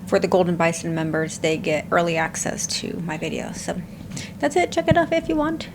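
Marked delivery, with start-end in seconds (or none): 4.4-5.7 high singsong voice. Acted. Casual sign-off after membership plug.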